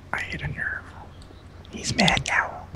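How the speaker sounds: gleeful whisper. Real, not acted. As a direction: whisper a gloat aside. Whispered gloating aside.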